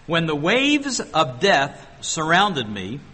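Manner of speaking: slow, weighty reading of the verse; solemn slow recitation; slow scripture reading. Shown as a demonstration. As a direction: let each word of the verse land slowly. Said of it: "picture yourself here".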